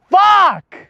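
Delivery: sudden high, loud yell; exasperated shout. Real, not acted. Vlogger venting after mocking himself, exasperated shout. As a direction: let the swear burst out, high-pitched.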